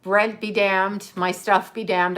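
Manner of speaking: usual speaking voice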